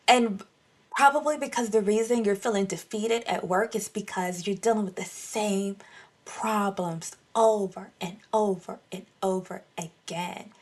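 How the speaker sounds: normal talking voice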